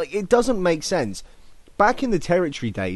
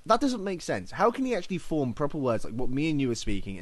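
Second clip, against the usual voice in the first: ordinary talk, nothing marked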